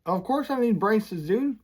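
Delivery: high-pitched disbelief; incredulous. Retold or imitated. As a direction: act like they must be joking. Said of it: "I was like".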